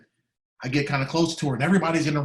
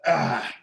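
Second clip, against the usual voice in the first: a real catch of tears in the breath; choked sob. Real, not acted. Standing by his dying teacher, a choked real sob. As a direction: let the sob break through.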